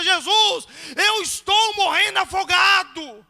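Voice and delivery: loud, high, drawn-out cry for help; full-force acted desperate yell; drowning shout. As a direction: scream to Jesus as if drowning. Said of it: "você grita".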